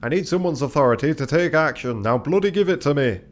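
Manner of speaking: gruff-tempered crewman, demanding. Acted. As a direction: demand help from a reluctant officer, loudly.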